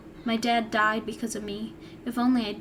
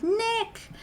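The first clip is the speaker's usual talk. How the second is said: a voice calls out high; yelled name; 11 semitones higher than usual. Acted. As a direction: call the name high in alarm. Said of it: "a voice yelled".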